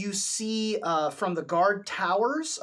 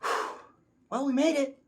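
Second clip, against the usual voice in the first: relieved sigh then light voice; lightly voiced relieved driver; relieved NPC voice; 8 semitones higher than usual. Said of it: "Lulu comes down with you and says".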